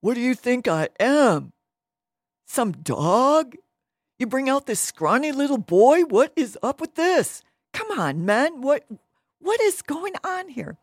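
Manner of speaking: loud, high, outraged mock bellowing; Goliath having fits. Giant insulted by a scrawny challenger, loud acted outrage. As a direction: throw a hammed-up fit at the insult. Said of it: "he was having fits".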